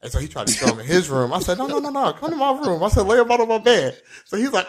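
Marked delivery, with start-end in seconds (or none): 1.6-4.0 eager insistence. Retold or imitated. Eager insistence about snapbacks.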